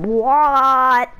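normal talking voice

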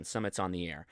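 normal talking voice

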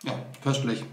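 talking with a mouth full; words round a bite of food. Real, not acted. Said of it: "Mit vollem Mund spricht man aber nicht".

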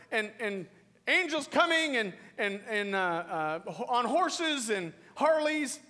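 high, loud, driving sermon; full-force preaching. Real, not acted. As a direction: preach it hard to the congregation.

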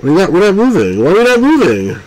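rising disbelief at the stalled sub; frustrated. Real, not acted. Player frustrated the submarine won't move, climbing whine.

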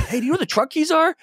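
high, fast and panicky, asking for the truck keys; mock frantic plea to his wife; frantic retold call. Husband on the phone to his wife about the truck keys, high and frantic. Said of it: "And I call her, like".

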